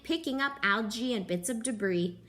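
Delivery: ordinary delivery, nothing marked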